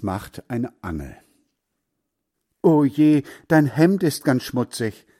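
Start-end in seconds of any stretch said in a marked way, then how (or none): 2.6-5.1 loud, higher-pitched call; exclaiming. A narrator's reading. Heinz seeing his friend's muddy shirt, calling out. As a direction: call out in dismay. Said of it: "rief Heinz".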